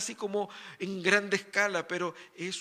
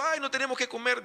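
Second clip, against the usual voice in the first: higher-pitched imitation of complaining; a retold wail, pitched up; mock whining; 6 semitones higher, 4 dB louder than usual. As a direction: lightly mimic a tearful complaint. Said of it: "Estaban llorando en el desierto".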